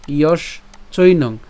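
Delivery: emphatic syllable split ইয়শ চৈনং. Shown as a demonstration. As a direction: separate ইয়শ and চৈনং with a pause. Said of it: "তালব্য শ পর্যন্ত উচ্চারণ করছি".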